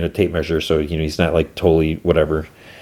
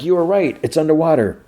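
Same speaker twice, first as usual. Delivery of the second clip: raised, high-pitched call through a door; urgent calling, not fully shouted; 6 semitones higher than usual. Retold or imitated. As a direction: pitch it up to carry to someone shut away. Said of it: "She's calling Solo".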